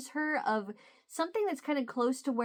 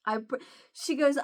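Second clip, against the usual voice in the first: ordinary delivery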